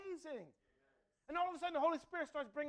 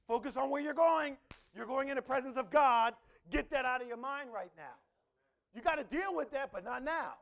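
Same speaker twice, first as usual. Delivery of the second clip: louder, commanding, urgent; firm voice of the Spirit; 10 dB louder than usual. Acted. Preacher voicing the Holy Spirit's stern rebuke, louder. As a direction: call him back to focus. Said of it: "And the Holy Spirit's like".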